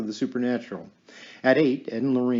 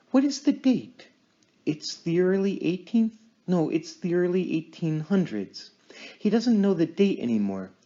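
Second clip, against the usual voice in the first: raised, intent medium in a trance; 6 semitones higher than usual. Acted. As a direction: speak as if channeling a spirit. Lorraine in trance voicing spirit.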